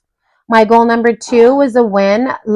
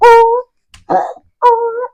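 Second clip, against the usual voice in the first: a high, delighted ooh; sudden happy squeak; excited squeal; 14 semitones higher than usual. Real, not acted. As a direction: squeal with glee at the finished binder. Excited about her finished binder.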